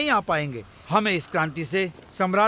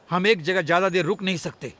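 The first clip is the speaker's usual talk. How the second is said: usual speaking voice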